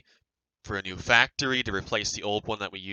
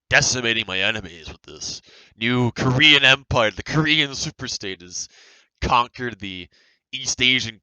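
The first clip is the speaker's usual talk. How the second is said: excited; 2 semitones higher, 6 dB louder than usual. Pretend. Mock war report of enemy attack.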